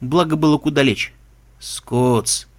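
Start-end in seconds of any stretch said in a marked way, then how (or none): 1.6-2.5 drawn-out, lower lethargic call; limp, stretched-out calling from bed; listless drawl. A narrator's reading. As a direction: drawl the name wearily. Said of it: "вяло протянул Кузьма".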